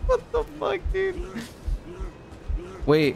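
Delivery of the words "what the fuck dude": shocked. Real, not acted. Sudden game shock.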